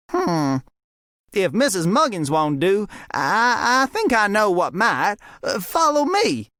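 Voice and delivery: high, stammering eager deputy. A narrator's reading. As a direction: hesitate then brighten with a plan. Fred's new idea.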